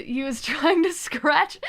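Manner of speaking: laughing through the words. Real, not acted. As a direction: giggle while saying it. Laughing while telling.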